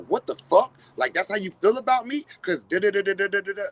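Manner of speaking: high, loud, indignant mock reply; hammed-up hurt partner snapping back; offended outburst. Partner hearing a hurtful thought, high and loud offended reply. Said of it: "and they go".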